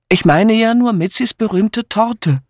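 apologetic. A narrator's reading. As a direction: excuse yourself lightly. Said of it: "entgegnete er entschuldigend".

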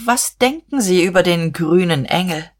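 ordinary delivery

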